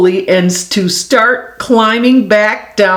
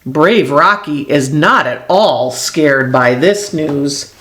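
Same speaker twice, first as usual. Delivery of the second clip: ordinary delivery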